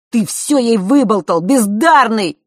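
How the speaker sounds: loud, high, slow insult; furious yell. A narrator's reading. Разъярённый брат кричит на младшего. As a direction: spit it out in fury. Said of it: "кричал разъярённый Норт".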